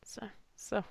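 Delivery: put-on high, soft but determined girl; vengeful girl. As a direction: swear revenge through grief. Voicing Clover, vowing revenge.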